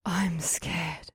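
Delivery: soft frightened whisper. Acted. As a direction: breathe it into his ear. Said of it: "she whispered into my ear".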